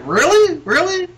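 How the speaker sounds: high, disbelieving double take; mock disbelief at the news; incredulous really. Friend reacting to wrestling booking, disbelieving. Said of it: "I was like".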